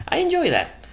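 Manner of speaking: normal talking voice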